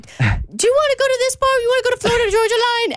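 high, fast pet voice; dog voice. Retold or imitated. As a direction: play the dog asking to go out. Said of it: "speaking like this with other people around you".

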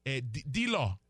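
put-on emphatic Nigerian accent. Analyst hyping players to the hosts, in Nigerian accent. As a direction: stumble over the names in a Nigerian accent. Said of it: "the accent".

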